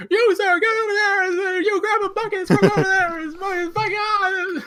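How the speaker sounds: very high, loud flustered commands; shouting ineffectual orders. Acted. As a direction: bellow useless orders in an over-the-top squeal. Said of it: "shouting totally ineffectual orders".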